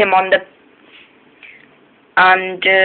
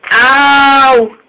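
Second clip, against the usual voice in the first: long high cry of pain; 7 semitones higher, 5 dB louder than usual. Acted. Lorry crashing into the trucks, high cry of pain. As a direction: yelp as it crashes.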